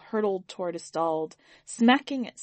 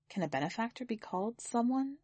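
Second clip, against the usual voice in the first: softer, wondering if someone fits; musing inner thought; 3 semitones higher, 8 dB quieter than usual. A narrator's reading. Akeem privately questions the word, quiet musing. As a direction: ask yourself quietly, doubting the word someone.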